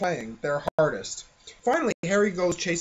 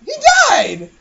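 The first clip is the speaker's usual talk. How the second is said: high, loud cry; mock shock; 10 dB louder than usual. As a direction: wail it out. Dramatic joke exclamation.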